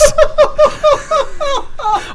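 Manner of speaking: shrieking laughter; high squealing laugh. Real, not acted. Cracks up reading post.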